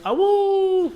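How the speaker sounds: high, loud wolf howl; howling like a wolf pup. Pretend. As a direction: throw your head back and howl. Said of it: "I'm like".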